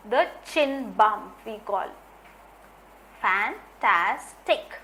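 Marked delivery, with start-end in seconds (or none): 3.2-4.8 Fantastic slowed into three beats, fan-tas-tic; slow, spaced fan-tas-tic; syllable beats. Shown as a demonstration. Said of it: "three syllable".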